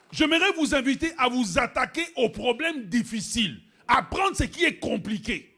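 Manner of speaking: loud, high preacher's cry; full force preaching. Shown as a demonstration. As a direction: hammer it at the congregation. Prêche à pleine voix. (prédication à pleine voix)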